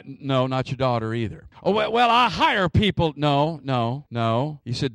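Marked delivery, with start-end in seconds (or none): 1.6-3.1 higher, louder bargaining voice; objecting listener. Acted. Preacher voices a listener dodging the Sabbath rule, pleading objection. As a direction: offer a hopeful excuse, pitched up.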